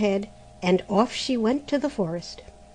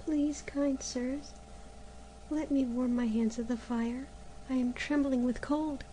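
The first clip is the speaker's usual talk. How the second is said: put-on timid pleading girl; 4 semitones higher, 8 dB quieter than usual. A cold girl pleading softly with the months. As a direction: beg shyly for warmth at the fire.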